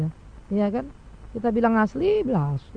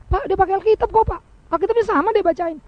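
higher voice insisting he uses the Bible; defensive congregant; 8 semitones higher than usual. Retold or imitated. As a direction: argue back at the pastor. Congregant defends false preacher.

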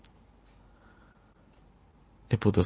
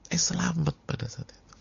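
awed whisper, lifting higher; whispered relief; 10 semitones higher than usual. Acted. Preacher telling listeners of rescue, awed whisper. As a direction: breathe it out like a secret blessing.

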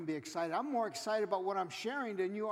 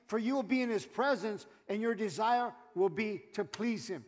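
ordinary talk, nothing marked

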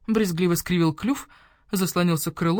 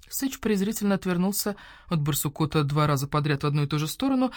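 usual speaking voice

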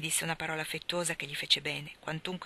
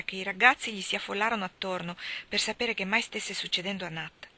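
plain reading voice

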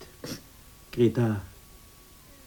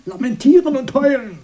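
mocking; 13 semitones higher, 4 dB louder than usual. Mocking sister's crying.

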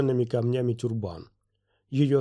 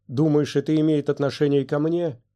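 usual speaking voice

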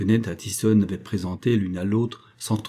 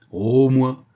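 louder, higher proud call; trumpeting; 4 semitones higher, 6 dB louder than usual. A narrator's reading. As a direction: announce it boldly to all. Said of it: "claironna Léon". (claironner, plus fort et plus aigu)

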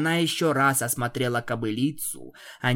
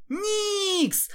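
much higher panicked cry; alarmed cry. Acted. Twilight cries out as Nyx is grabbed, alarmed cry. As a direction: cry out as she is seized.

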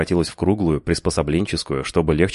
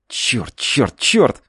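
frustrated cursing; 7 semitones higher, much slower than usual. A narrator's reading. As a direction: swear in rising panic. Феликс в отчаянии ругается. (отчаянная ругань)

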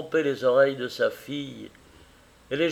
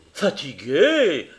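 slow, louder, incredulous; animated, drawn-out denial; 2 semitones higher, 4 dB louder, slower than usual. Acted. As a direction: scoff at the idea with spirit. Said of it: "avec beaucoup d'animation".

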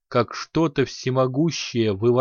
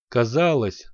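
plain reading voice

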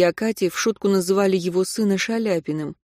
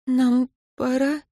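regretful; 6 semitones higher, slower than usual. Acted. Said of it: "с сожалением спросила Катя".

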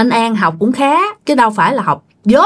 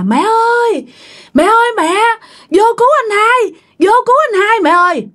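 put-on high-pitched, urgent child's cry to mother; frantic high call for help; 12 semitones higher than usual. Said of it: "la làng lên".

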